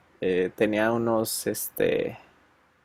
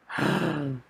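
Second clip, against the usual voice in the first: soft mock gasp of shock, higher; imitated gasp; 4 semitones higher, 7 dB quieter than usual. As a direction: gasp quietly as if caught out. Friend realizes misspelled tattoo. (grito ahogado fingido, suave y agudo)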